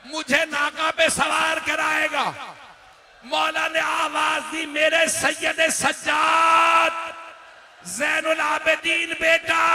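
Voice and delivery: high, loud, half-sung wailing, slow on the final lines; full-force lamenting sermon. Acted. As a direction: cry out the farewell as a sung lament. Zainab and Husain dialogue climax.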